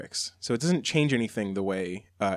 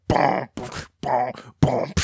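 booming mouth-made horn stabs; imitated horn hits; slower than usual. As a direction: punch out heavy horns and beat. Imitates the song's trap horn breakdown.

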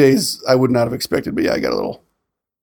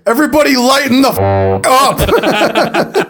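retold shout; 9 semitones higher, slower than usual. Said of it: "until I literally shouted".

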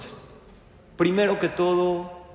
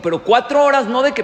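mock insistent wife; 7 semitones higher, 9 dB louder, faster than usual. Wife's insistence, voiced.

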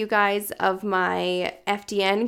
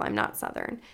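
whispered aside; 13 semitones lower, 8 dB quieter than usual. Real, not acted. Embarrassed aside after saying y'all.

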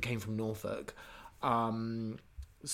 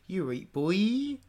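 drawn-out Norfolk lilt, rising at the end; higher, slow Norfolk accent; 6 semitones higher, 3 dB louder, much slower than usual. Shown as a demonstration. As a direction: stretch it out and lift it like a Norfolk local. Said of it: "the Norfolk accent. Yeah, which goes".

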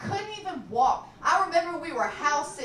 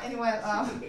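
normal talking voice

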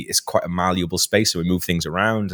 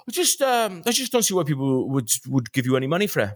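higher-pitched West Wales cameraman; West Wales accent; 5 semitones higher than usual. Retold or imitated. Cameraman doubting anyone would pay, West Wales accent. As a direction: mimic him in raised West Wales tones. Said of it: "very West Wales accent".

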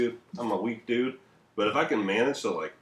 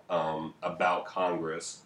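ordinary talk, nothing marked

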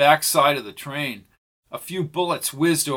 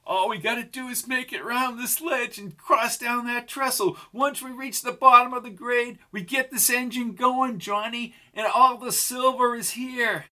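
higher woman's voice, hopeful and urgent; Pam, eager outlaw woman; 10 semitones higher than usual. A narrator's reading. Pam plans the escape with Johnny, higher and eager. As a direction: lift the pitch as the scheming partner.

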